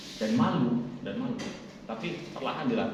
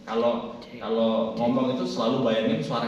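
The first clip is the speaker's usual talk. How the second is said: voice from the belly; 4 dB louder than usual. Shown as a demonstration. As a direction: let the voice drop out of the throat. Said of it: "suara kita jatuhnya ke perut".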